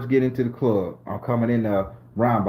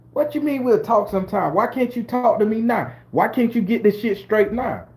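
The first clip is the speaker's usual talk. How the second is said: higher, insistent woman's voice; pushy woman; 10 semitones higher than usual. Acted. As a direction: press him like an impatient woman. Woman demanding an answer.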